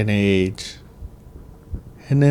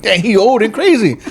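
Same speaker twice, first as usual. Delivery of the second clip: onlookers mocking; 8 semitones higher than usual. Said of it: "I'm like".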